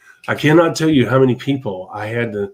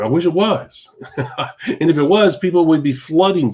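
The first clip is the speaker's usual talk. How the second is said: a wry laugh breaking through, higher; 5 semitones higher than usual. Real, not acted. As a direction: chuckle at your own wish. Wry joke about getting rich quick.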